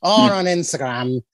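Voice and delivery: slow mock hillbilly drawl; hillbilly accent. Listing social handles, slow mock hillbilly. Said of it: "this is my hillbilly accent".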